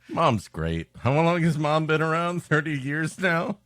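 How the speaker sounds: ordinary talk, nothing marked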